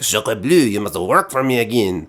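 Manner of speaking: hammed-up French accent; mock French villainess accent. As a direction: redo the villain's demand as a cartoon French woman. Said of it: "or I guess she's French".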